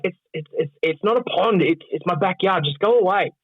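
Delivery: high-pitched, stammering protest; mock exasperation. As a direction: act out fed-up insistence, voice pushed up. Acting out annoyance at prank visitor.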